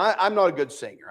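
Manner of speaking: ordinary talk, nothing marked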